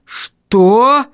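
high, incredulous burst; rising outraged cry; thundering. A narrator's reading. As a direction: thunder it in disbelief. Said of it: "загремел я".

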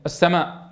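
السماء with a brief natural aa, no extra stretch; natural madd only. Shown as a demonstration. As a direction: say السماء plainly short at the end. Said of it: "سمعنا صوت الألف".

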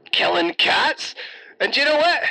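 normal talking voice

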